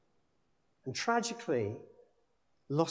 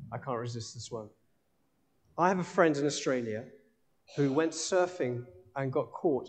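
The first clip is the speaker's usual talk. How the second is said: ordinary talk, nothing marked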